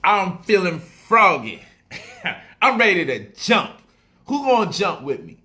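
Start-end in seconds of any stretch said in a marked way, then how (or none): none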